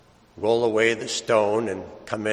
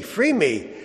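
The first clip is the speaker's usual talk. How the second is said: high, loud, pleading mock whine; mock a spoiled Lazarus bargaining; whiny demanding Lazarus; 14 semitones higher, 9 dB louder than usual. Preacher mocking a Lazarus who bargains with Jesus, high whiny plea. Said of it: "mocking a demanding Lazarus".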